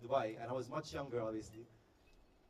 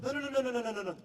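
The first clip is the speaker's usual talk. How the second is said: rapid-fire high, loud string of no's; urgent interruption; 8 semitones higher, 5 dB louder, much faster than usual. Retold or imitated. Woman stopping him giving his name, urgent interruption. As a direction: cut them off before they can finish.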